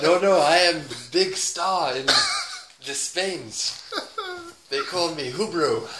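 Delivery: slow, boastful mock Spanish accent. Player claiming to be Spain's star, mock Spanish accent. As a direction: play the big Spanish star, slowly. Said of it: "Give me Spanish".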